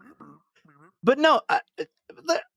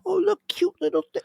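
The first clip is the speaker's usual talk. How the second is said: much higher, cooing voice; stupid scientist. Retold or imitated. Cohost imitating the Prometheus scientist, cooing high. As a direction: gush over the creature like a fool. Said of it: "like that Prometheus ... stupid scientist".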